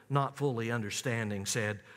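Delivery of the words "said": ordinary talk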